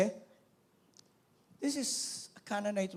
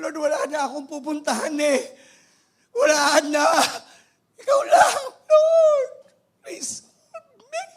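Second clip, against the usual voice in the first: much higher, louder, crying out; crying plea; 8 semitones higher, 12 dB louder than usual. Acted. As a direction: beg Jesus through tears, as the last hope. Mother's desperate plea acted. (umiiyak na pagsusumamo)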